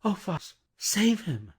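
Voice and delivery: high desperate plea; pleading in fear. Acted. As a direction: beg for his life. Lucy pleads in terror.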